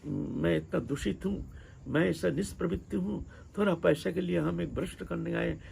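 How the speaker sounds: soft, mildly voiced inner disgust; self-reproach. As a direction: quietly imitate someone reproaching herself. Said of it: "पहले तो धिक्कार आता है".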